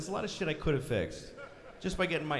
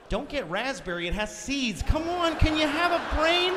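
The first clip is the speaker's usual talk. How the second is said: nagging wife; 10 semitones higher, 3 dB louder than usual. Retold or imitated. Nagging spouse voiced.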